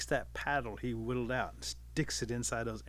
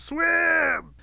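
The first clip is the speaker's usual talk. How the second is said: high, loud, long-held yell; urgent holler; 11 dB louder than usual. Acted. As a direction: holler it after someone swimming off. Rabbit shouting after fleeing Otter.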